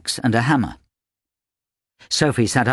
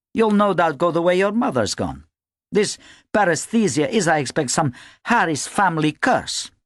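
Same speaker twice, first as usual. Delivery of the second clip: higher-pitched, accusing tirade; 8 semitones higher than usual. A narrator's reading. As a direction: lay the blame in a raised pitch. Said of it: "she accused him".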